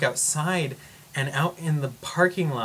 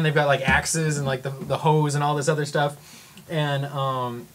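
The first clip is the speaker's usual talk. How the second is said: ordinary talk, nothing marked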